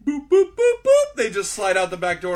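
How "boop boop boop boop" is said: high, loud cartoon boops; mock sneaking boops. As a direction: squeak out a sneaky exit tune. Sneaking-out sound effect.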